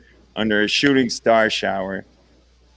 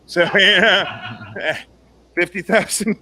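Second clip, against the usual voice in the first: laughing through it; 4 semitones higher, slower than usual. Real, not acted. Laughing at own punchline.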